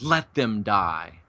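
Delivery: low, slow and quiet, a lightly voiced Kirk; a hint of grim Kirk, drawn out low; Captain Kirk. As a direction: drop the voice low and let the words fall slowly. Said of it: "And Captain Kirk says".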